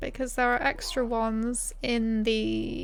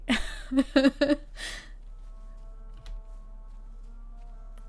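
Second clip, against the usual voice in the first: breathy giggle; 3 semitones higher, 4 dB quieter than usual. Real, not acted. Laughing at own accent.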